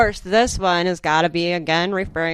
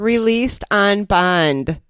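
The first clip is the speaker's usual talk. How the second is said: slow, drawn-out incredulous echo; pointed disbelief; 3 semitones higher, 4 dB louder, much slower than usual. Real, not acted. Co-host echoing news of a suspect's release, slowly incredulous. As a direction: stretch each word in disbelief.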